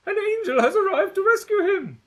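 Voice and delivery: high, loud mock announcement; hammed-up cheer for the rescuer; mock hopeful cry. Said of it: "I hear you cry".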